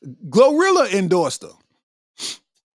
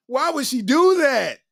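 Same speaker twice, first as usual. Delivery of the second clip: usual speaking voice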